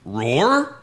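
questioning "roar", rising at the end; mock tentative roar; uncertain script reading. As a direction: read the cue as a puzzled question. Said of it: "And then you roared. He's like".

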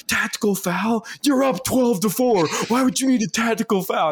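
mocking the angry older guy. Older opponent scolding him over the foul, high indignant mock. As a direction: ham up his outraged disbelief.

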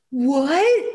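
high startled what; puzzled cry at the script; baffled what. Retold or imitated.

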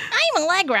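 high, childish imitation of Allegra; a light mock of her baby voice. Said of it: "your Allegra voice".

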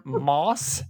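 MOSS drawn out with a plain s; misheard country pronunciation. Retold or imitated. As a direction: stretch MOSS as the misheard word. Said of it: "did anybody else hear".

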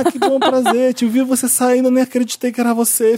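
excited fan greeting. Retold or imitated. Fan outside the building greets the podcaster, gushing and high. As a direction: gush like a thrilled listener meeting him.

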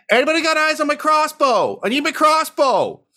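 panicked squeaky hunt for his weapon; frantic Phantom. Acted. Phantom calling out mid-combat, searching for his crossbow, frantic and high. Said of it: "hear him say".

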